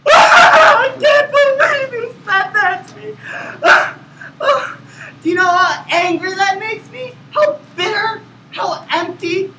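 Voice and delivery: high, wailing mock sobs through the words; fake crying. Shown as a demonstration. Same outburst redone with hammed-up crying for first place. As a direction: boohoo through every line for the judges. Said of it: "it's recommended that you cry".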